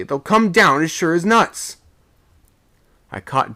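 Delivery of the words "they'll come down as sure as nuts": high and loud, desperate; a sailor shouting in helpless fear. A narrator's reading. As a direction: cry out in helpless alarm. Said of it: "I shouted helplessly".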